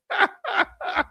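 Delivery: high-pitched chuckle; laugh. Real, not acted. Host to the chat family after teasing H-Town, high laugh.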